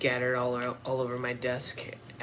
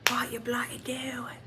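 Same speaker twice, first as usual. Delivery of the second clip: put-on Australian accent; 9 semitones higher, 3 dB quieter than usual. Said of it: "I'm Australian".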